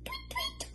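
very high, soft chirps; tiny twittering tweets; birdsong tweet. Acted. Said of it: "went the birds".

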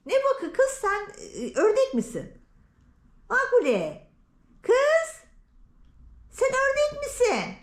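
high, exclaiming mock telling-off; mock scolding. Owner confronts her cat for quacking, mock scolding. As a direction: call the pet out in exaggerated disbelief.

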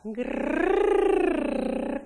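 high, loud rolled grrr; long purring grrr; cat purr growl. Shown as a demonstration. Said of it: "a very nice grrr sound".

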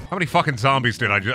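usual speaking voice

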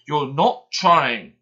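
loud, slow shout; angry driver's shout. Acted. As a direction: shout at the lazy engine. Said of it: "he shouted at Gordon".

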